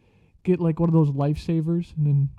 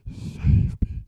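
faint whispered plea; whispering; 5 dB quieter than usual. Retold or imitated. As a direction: breathe it out weakly. Lying on the ground after fainting.

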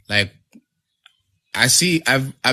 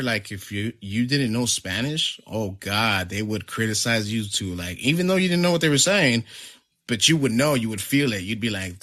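ordinary delivery

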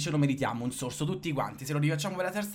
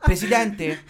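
high, loud questioning host call; Paola Perego voice; 5 semitones higher, 8 dB louder, much slower than usual. Retold or imitated. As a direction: call it out like a TV hostess. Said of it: "Paola Perego".

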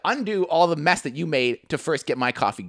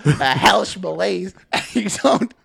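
words dissolve into laughter; laughing. Real, not acted. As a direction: crack up mid-sentence. Breaks into laughter.

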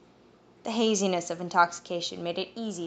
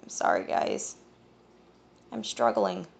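face down; 11 semitones lower than usual. Acted. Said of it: "forehead on the table".

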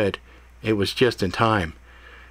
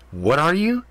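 mocking his question, higher with an edge; edgy; 7 semitones higher than usual. A narrator's reading. Cat mocking Ethan's question, much higher with an edge. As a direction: throw the question back at him. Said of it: "she said with an edge in her tone".

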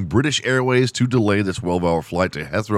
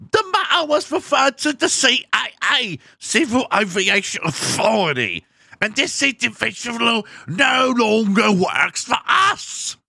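put-on British accent; 13 semitones higher than usual. Comedian quotes the spokesperson in a high British accent. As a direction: shriek the statement like a prim British official. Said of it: "I need to do a British accent".

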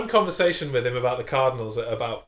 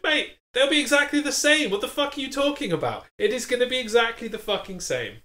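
exasperated rant; 10 semitones higher than usual. Retold or imitated. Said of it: "I'm like".

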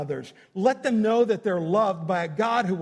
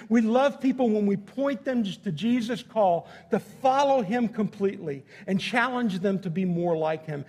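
ordinary talk

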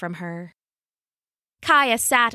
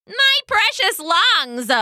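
croaking cheetah; 12 semitones higher, 4 dB louder, slower than usual. Acted. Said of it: "croaked the cheetah".

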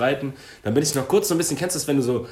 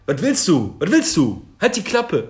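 higher, louder aggressive snapping back; rising rage, shouting at a stranger; retold angry yell; 6 semitones higher, 6 dB louder, slower than usual. Retelling how he yelled back at the driver, angry shout. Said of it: "Und ich so".

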